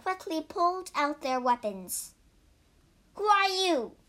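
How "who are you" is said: high, sharp yelled question. Acted. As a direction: shout the challenge at the tiger. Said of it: "yelled Bajie".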